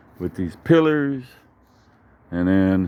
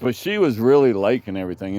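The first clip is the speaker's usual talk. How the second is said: ordinary talk, nothing marked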